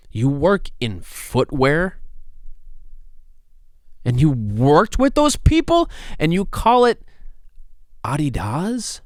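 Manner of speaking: high, loud, slow disbelieving comic rant; Chris D'Elia rant. Pretend. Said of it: "my Chris D'Elia comes out".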